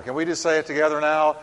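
usual speaking voice

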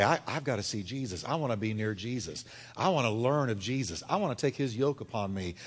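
low, soft personal resolve; quiet devotional longing; hushed yearning. Acted. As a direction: say it inwardly, low and earnest. Voices awakened hunger for Jesus.

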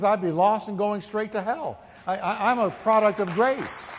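building pulpit testimony; fiery preaching. Acted. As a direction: preach it with mounting fire. Preaching climb into confession.

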